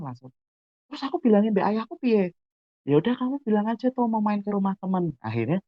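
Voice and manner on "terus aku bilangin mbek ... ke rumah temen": high-pitched imitation of friends scheming; dialog rencana teman. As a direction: act out both friends in a raised pitch. Dua sahabat merencanakan alasan. (menirukan rembukan teman, nada tinggi)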